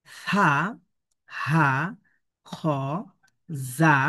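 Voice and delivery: each syllable isolated by a long pause; careful pronunciation drill, one sound at a time; slow syllable reading. Shown as a demonstration. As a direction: separate Arabic letter sounds, "সা", "হা", "খ". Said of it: "আমি প্রথমে ধীরে ধীরে আমি পড়ছি".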